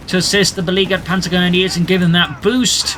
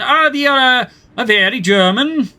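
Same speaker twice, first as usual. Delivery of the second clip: put-on sing-song German accent that slips into Swedish; badly faked German, accidentally Swedish-sounding; failed German accent; 6 semitones higher, 3 dB louder than usual. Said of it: "I don't know how to do a German accent".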